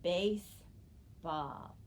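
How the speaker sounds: "baseball" very slow, base, pause, ball; teacher sounding out "baseball" slowly and evenly; syllable blending demo. Shown as a demonstration. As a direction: stretch "baseball" into two separate syllables. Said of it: "my new word is".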